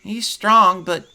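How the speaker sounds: put-on high, earnest child voice; a little boy recalling the scary raid, pitched high; young boy. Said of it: "he looks at you and goes".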